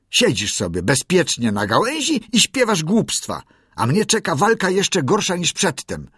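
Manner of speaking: high, loud scolding rising to a shriek; angry mongoose. A narrator's reading. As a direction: Riki berates the singing bird. Riki scolds the bird.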